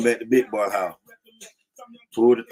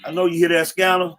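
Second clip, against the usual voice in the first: higher, louder, hurried wake-up urgency; urgent warning; 7 semitones higher, 4 dB louder than usual. Retold or imitated. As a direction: snap awake and warn the driver. Partner wakes to scanner alarm.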